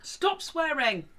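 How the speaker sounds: shouted and drawn out; exasperated shout. Real, not acted. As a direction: yell it in exasperation. Scolding friend for swearing.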